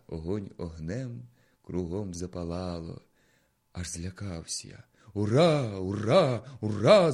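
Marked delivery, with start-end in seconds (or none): 5.1-7.1 cheering crowd shout. Acted. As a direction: roar it like a parade crowd. (гучний радісний вигук натовпу)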